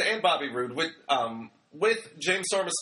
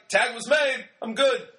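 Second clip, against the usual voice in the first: raised pitch, playfully self-satisfied; mock breezy excuse; 7 semitones higher, 4 dB louder than usual. Wrestler leaving the ring after a fake tag, mock breezy. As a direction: call it out as if off the hook.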